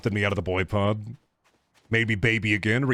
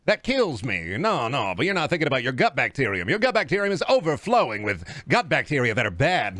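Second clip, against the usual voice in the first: transatlantic snake-oil pitchman; smooth patter with swooping old-time inflection; old-timey radio salesman. Pretend. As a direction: sell it like a vintage radio huckster. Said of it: "That voice generally makes me sold".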